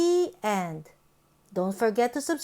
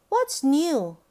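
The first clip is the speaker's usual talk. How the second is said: ordinary delivery, nothing marked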